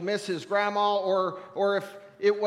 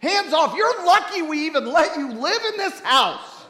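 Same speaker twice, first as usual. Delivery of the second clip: angry sad; 5 semitones higher, 6 dB louder than usual. Retold or imitated. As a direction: scold the new owners like they wrecked family history. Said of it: "And now I was angry sad".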